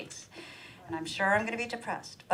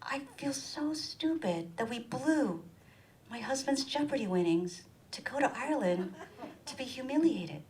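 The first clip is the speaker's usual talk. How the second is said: whisper; 5 semitones higher, 7 dB quieter than usual. Retold or imitated. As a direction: whisper it ashamed. Confessing to friend.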